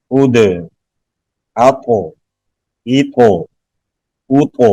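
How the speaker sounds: echoing bounce on each stopped final consonant; qalqalah demonstratie. Shown as a demonstration. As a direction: recite each syllable crisply with a small rebound on the last letter. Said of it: "حروف قلقلہ تھے".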